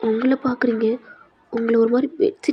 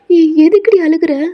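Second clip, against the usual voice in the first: higher, louder worried question; alarmed friend; 5 semitones higher, 6 dB louder than usual. Acted. Friend asks why she is crying, alarmed. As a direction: ask it in alarm.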